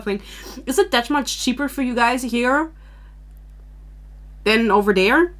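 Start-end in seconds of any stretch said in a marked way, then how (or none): none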